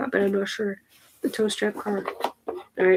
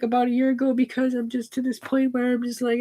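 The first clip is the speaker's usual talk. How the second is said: usual speaking voice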